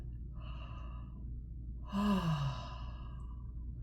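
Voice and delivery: soft exhaled sigh on a low note; low breathy sigh. Shown as a demonstration. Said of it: "Take a deep, low breath".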